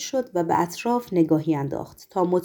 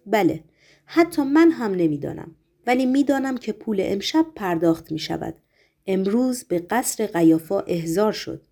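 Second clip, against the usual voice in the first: usual speaking voice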